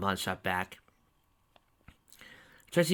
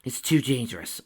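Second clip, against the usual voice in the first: plain reading voice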